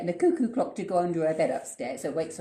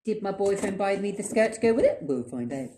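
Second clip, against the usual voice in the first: ordinary talk, nothing marked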